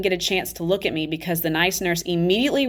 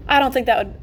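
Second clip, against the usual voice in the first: a hint of a babyish whine, higher; 6 semitones higher, 4 dB louder, faster than usual. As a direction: sound like a little child. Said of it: "I made my voice sound like a little babyish".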